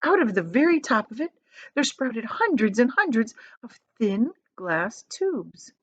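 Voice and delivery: plain reading voice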